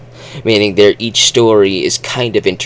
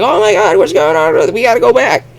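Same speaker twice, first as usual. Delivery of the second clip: high, loud alarmed settler voice; mock panic about Earth; panicked settlers; 13 semitones higher, 3 dB louder, faster than usual. Mars settlers panicking over Earth's war, high alarmed voice. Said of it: "they go like".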